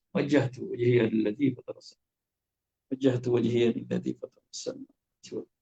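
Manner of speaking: soft, devout reading; hushed prayer recitation; reverent recitation. Shown as a demonstration. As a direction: recite it softly, with awe. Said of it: "Macam mana gaya lagi".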